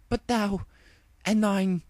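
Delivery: high, halting royal sputter; flustered regal stammer. Acted. Luna sputtering at the two ponies, high flustered archaic voice. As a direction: grasp for words in old speech.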